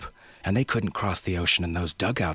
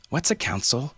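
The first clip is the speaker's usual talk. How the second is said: put-on higher, innocent child's question; curious little girl; 7 semitones higher than usual. A narrator's reading. Girl puzzled by the grown-ups' quarrel, innocently asking. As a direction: ask it wide-eyed like a small child. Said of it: "Mierna asked".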